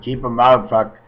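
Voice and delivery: normal talking voice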